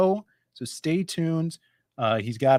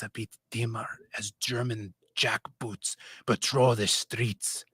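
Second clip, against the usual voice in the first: put-on sneaky Middle Eastern narrator accent; 5 semitones lower than usual. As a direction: intone a dark tale in a Middle Eastern accent, dropping toward a whisper. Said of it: "sneaky Middle Eastern who snuck into France".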